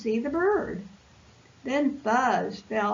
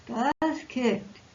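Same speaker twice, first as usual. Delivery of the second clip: usual speaking voice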